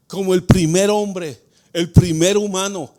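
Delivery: usual speaking voice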